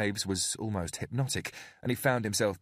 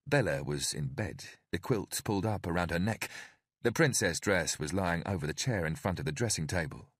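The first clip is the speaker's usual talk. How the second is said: usual speaking voice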